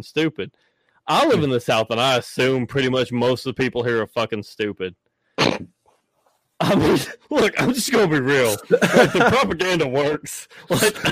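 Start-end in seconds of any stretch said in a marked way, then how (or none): 5.4-11.0 high voice breaking into laughter; laughing admission. Real, not acted. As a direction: confess it while cracking up. Cracks up at own admission.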